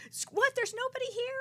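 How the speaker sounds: put-on high, baffled boyfriend voice; mock confused boyfriend. Boyfriend woken by her screams, high and confused. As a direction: play the boyfriend squeaking in disbelief.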